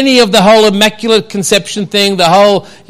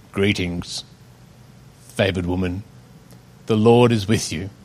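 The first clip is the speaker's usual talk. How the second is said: deep angel voice; 12 semitones lower, 10 dB quieter than usual. Shown as a demonstration. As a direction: announce it like a heavenly angel. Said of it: "I should have different sound effects".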